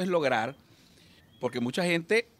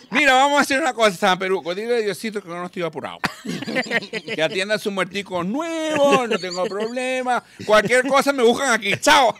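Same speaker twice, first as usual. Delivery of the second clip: put-on high, loud cheerful drunk voice; high tipsy man cheerfully declining to meet God; merry drunk; 7 semitones higher, 3 dB louder than usual. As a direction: wave off God happily and head for the bar. Drunk dismisses God for bar.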